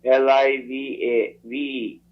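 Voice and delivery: slow, letter by letter; dictating. Real, not acted. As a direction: spell each letter out slowly. Spelling out the address slowly.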